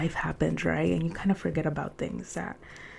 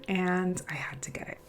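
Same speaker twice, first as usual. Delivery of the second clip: whisper; 7 dB quieter than usual. Real, not acted. Confessing a purchase.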